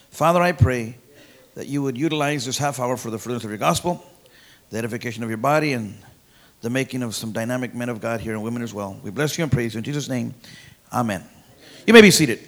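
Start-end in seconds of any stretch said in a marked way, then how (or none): 0.1-11.2 soft, low prayer voice, lower than the preaching; quiet prayer. Acted. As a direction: pray it quietly to God. Opening prayer before the sermon.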